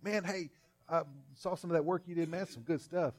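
eager friendly greeting. Acted. Preacher voicing Haskell greeting [name], high and cheery. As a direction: call out cheerfully like an old buddy. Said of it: "Haskell comes up behind me and says".

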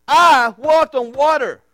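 loud, slow, drawn-out boast; hammed-up bragging, each word punched loudly; mock boast. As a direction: proclaim it like a big achievement. Acts out Peter's imagined boast.

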